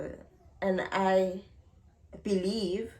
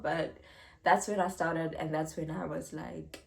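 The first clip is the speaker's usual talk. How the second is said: ordinary talk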